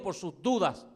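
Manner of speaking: ordinary talk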